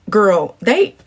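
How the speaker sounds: ordinary talk, nothing marked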